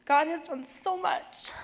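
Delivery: overcome, voice rising high and slow; emotional. Real, not acted. Telling the audience what God has done, choked up. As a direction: push the words out through real tears. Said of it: "why I'm emotional".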